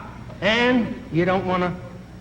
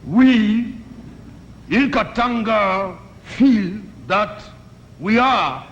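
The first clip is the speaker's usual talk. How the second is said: put-on African diplomat accent; 6 semitones higher than usual. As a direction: speak like a grand diplomat with a put-on African accent, drawing out the words. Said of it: "the guy from Katanga says".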